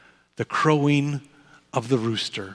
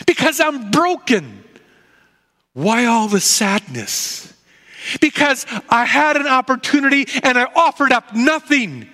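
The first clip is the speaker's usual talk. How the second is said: higher, pleading voice of a broken Peter; Peter, remorseful; 7 semitones higher than usual. Acted. Peter confessing his failure after the rooster crows, higher and remorseful. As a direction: let Peter pour out his shame, pitched up.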